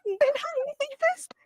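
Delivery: put-on very high thrilled child squeal; excited kid. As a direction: squeal with delight.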